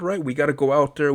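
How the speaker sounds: ordinary talk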